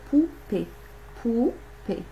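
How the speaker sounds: "poupée" said as pou, pause, pée; split into syllables. Shown as a demonstration. Teacher helping children hear first syllable, poupée split into syllables. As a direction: separate the two beats of "poupée", pou... pée. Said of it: "La première syllabe que vous entendez".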